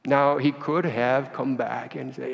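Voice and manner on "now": ordinary talk, nothing marked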